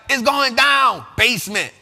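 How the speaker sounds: loud shouted punchy line. Retold or imitated. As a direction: shout it out like a hype rap tag. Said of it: "when she used to say stuff like that".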